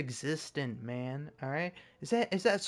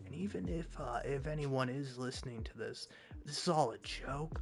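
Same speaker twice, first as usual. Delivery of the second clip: hushed and much softer, half whispered; talking quietly; 8 dB quieter than usual. Real, not acted. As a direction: keep it down so nobody overhears. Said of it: "I'm talking quiet 'cause I don't want Hi-Rez to hear".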